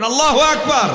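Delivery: "আল্লাহু আকবার" high and very loud; rally the crowd to answer; loud call. Real, not acted. Preacher urging the crowd to shout the takbir. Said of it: "বলেন".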